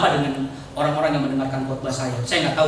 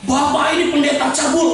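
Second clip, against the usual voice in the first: quoted accusation; 9 semitones higher, 4 dB louder than usual. Retold or imitated. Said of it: "saya dibilang gini waktu itu".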